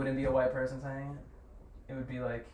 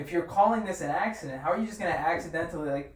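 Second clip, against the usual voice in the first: ordinary talk, nothing marked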